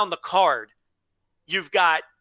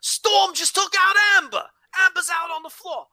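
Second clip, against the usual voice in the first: put-on very high, shouted Australian commentator accent; 14 semitones higher, 4 dB louder than usual. Podcast host redoing the Australian commentary call, shouted high Australian accent. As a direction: yell the call like an overexcited Australian announcer. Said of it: "if you're ever gonna do an Australian accent".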